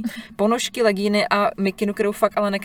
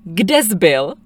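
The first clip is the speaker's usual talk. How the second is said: mock police parent; 7 dB louder, much slower than usual. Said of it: "typ rodičů jsou policajti".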